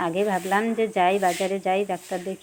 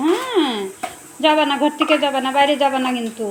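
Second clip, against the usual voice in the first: put-on baby talk; 4 semitones higher, 5 dB louder than usual. Cooing to pet, high.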